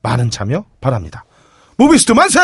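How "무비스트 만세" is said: high shouted slogan cheer; shrill echoing propaganda broadcast. Pretend. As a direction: belt it like a rally chant. Closing sponsor cheer.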